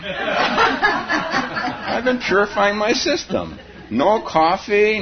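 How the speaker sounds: slow, high, laughing through purifying. Acted. Teacher laughing at his own monastery purity, slow and high. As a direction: stretch it out while laughing. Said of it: "repeats phrase laughing".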